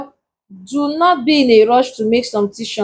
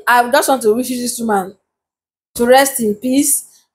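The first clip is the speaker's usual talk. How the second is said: usual speaking voice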